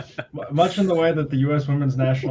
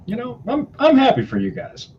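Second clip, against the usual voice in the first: much higher, louder, sing-song fake cheer; forced politeness; 11 semitones higher, 4 dB louder than usual. Telling Iowa fans around him, mock cheerful congratulations. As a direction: chirp it like you don't mean it. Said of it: "So I'm just kinda like".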